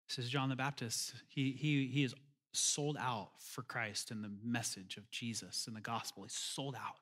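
hushed intensity. Real, not acted. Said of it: "soft intense emphasis".

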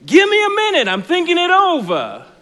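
hammed-up nervous victim, almost laughing; dithering victim. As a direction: squeak it out like a man truly weighing his wallet. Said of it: "And the guy goes".

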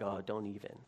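low, soft parent's warning. Retold or imitated. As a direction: hush a freaking-out kid. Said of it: "you're just like".

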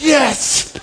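high, loud cry of fatherly victory; triumphant. Retold or imitated. Dad reacting to his daughter rejecting her date, triumphant. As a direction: crow it proudly, retelling your own delight.

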